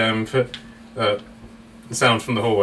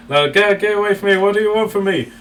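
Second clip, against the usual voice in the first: higher, louder panicked yell; panicked Luke; 9 semitones higher, 4 dB louder than usual. Retold or imitated. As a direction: pull away in panic. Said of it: "and Luke's like".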